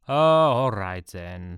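suddenly breezy and relieved; brightened. Acted. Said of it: "the officer brightened".

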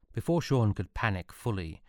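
plain reading voice

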